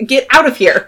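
high, loud acted dismissal; bossy nurse's order. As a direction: shoo him out loudly.

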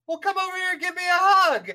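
high, upbeat friendly invite; cheerful YouTuber. Retold or imitated. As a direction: sound warmly welcoming. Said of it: "and he's like".

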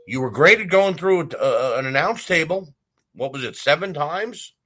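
a mocking jab at a wrestler; sarcastic praise. Real, not acted. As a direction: praise it so it stings. Mocking praise of table spots.

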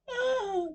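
startled high-pitched shriek; sudden high "ah"; high cry. Acted. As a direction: cry out as the lights go out. Lights go out, guests cry out.

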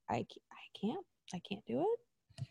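whispered I can't; whispered despair. Pretend. A fan giving up on Alexa, whispering. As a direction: breathe it out, defeated.

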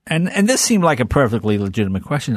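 ordinary delivery

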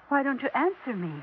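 normal talking voice

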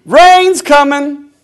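slow, high, loud drawn-out call; slow warning cry. Acted. Preacher calling out that the rain is coming, slow and loud. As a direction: stretch it out like a warning from the boat.

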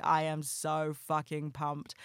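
ordinary talk

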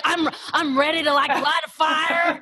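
high, slow Southern imitation of a husband; Southern accent. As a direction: play the eager Southern survivalist with a drawl. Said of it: "the accent came out".

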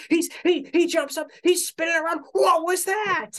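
high, loud, stunned announcer call; wrestling commentator. Retold or imitated. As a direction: shout the play-by-play in disbelief. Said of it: "the announcer's like".

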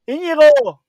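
llamando de lejos. Real, not acted. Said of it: "Me ha parecido escuchar gritar a [name]".

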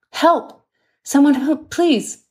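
high, loud panicked call for help; desperate cry for help. Acted. Wounded nurse calling out in the night, panicked loud cry. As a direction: yell for help in terror down the corridor.

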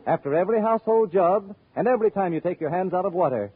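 ordinary talk, nothing marked

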